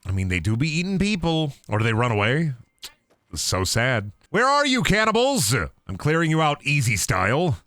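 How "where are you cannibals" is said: high, sing-out hunting call. Pretend. Gamer hunting cannibals through the camp, calling out. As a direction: holler playfully for hidden foes.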